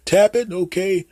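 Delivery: put-on high, hesitant old dad voice; clueless dad. Dad calling his son Jim for phone help, high and unsure. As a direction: play the fumbling father asking for help. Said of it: "You go up to them".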